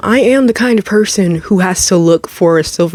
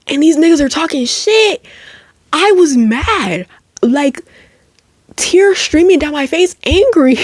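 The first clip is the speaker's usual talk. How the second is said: retold anger, high voice; 7 semitones higher than usual.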